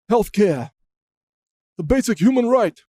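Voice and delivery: Bernie Sanders impression. Senator repeating his healthcare slogan, mock declaiming. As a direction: mimic a senator proclaiming a slogan. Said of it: "That's my impression of Bernie Sanders right there".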